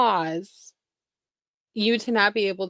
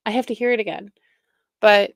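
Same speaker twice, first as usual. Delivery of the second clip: normal talking voice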